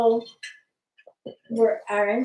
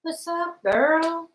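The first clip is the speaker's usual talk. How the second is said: excited greeting; 14 semitones higher, 5 dB louder, slower than usual. Real, not acted.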